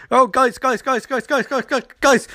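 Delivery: high, rapid-fire repeated pleading; mock panicked calling. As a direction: call urgently to the team, hammed-up. Voicing Flash calling to his team.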